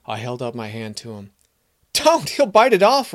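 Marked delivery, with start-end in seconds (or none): 1.9-3.1 much higher, louder panicked warning; alarmed cry. Acted. Stable boy Robin yells a warning as the boy reaches out, high and loud. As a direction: yell to stop him before it's too late. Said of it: "Robin cried".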